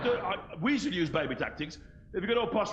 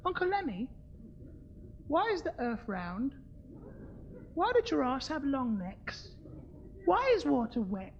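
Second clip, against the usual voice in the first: put-on child's voice; 8 semitones higher, 5 dB quieter than usual. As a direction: ask like a wide-eyed little kid. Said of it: "they say".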